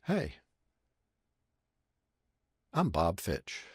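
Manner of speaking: soft, personal hello with a long pause; hushed imitation of a director's intimate greeting; one-to-one introduction. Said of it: "he looks at the first person. He said".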